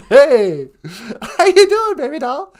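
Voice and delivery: put-on high, loud old lady voice; grandma character voice. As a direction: chirp it like a sweet old granny. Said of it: "Nana Bonjour".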